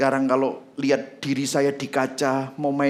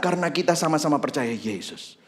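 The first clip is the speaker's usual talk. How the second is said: ordinary delivery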